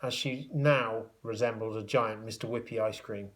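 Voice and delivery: usual speaking voice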